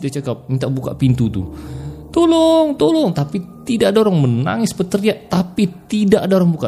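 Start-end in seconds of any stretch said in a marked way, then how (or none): 2.1-3.1 high, desperate pleading; tearful cry for help. Retold or imitated. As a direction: cry out for help, trapped and scared. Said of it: "Sampai dia menangis-nangis". (jeritan minta tolong sambil menangis, nada tinggi)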